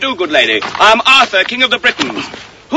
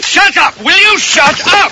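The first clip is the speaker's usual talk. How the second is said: exasperated shout, higher; angry shout; 6 semitones higher than usual. Acted. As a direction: snap "shut up" at him. King losing his temper.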